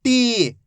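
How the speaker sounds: टी with a long, held ee; teacher drawing out टी; drawn-out syllable. Shown as a demonstration. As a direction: stretch the vowel in टी for learners. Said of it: "खींच खींच के पढ़ना है".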